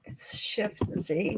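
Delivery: breathless unvoiced muttering; whispered to herself. Real, not acted. Sorting out the stream settings alone, whispering to herself. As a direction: whisper to herself while fiddling with the screen. Muttering while finding shortcut.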